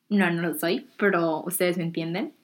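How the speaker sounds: ordinary talk, nothing marked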